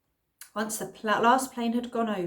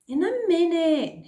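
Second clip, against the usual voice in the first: dismissive; 9 semitones higher, 8 dB louder than usual. Acted. Cliff brushing Olive off.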